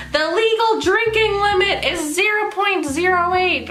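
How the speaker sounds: high, slow put-on voice; lady explaining limit. Hospital lady explaining the legal limit, high slow voice. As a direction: spell it out slowly, pitched high.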